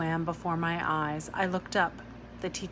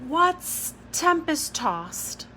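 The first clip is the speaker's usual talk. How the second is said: slow, high curious question; 9 semitones higher, 3 dB louder, much slower than usual. Acted. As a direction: sound it out slowly. Curious child asks slowly.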